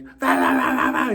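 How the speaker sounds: very loud babbling, leaping up high; acted-out hysterical yammering; mock frantic babble. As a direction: rant over them at full volume. Acted ranting gets louder.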